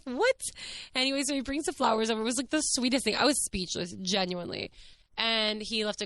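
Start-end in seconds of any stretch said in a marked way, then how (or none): 0.0-0.4 high-pitched incredulous yelp. Real, not acted. Vlogger reacting to her princess-like date, incredulous. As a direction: pitch it way up in disbelief.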